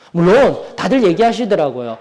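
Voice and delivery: ordinary talk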